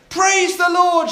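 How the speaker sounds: high, loud, slow cry of praise; shouting. Acted. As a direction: shout thanks up to heaven. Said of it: "she shouted".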